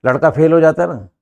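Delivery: ordinary delivery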